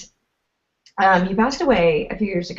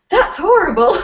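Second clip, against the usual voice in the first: high, loud laughing protest; mock horror; 11 semitones higher, 6 dB louder than usual. Reacting to uncle's odd wedding advice, mock horrified. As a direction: imitate a scandalised reaction, laughing. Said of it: "at first I was like".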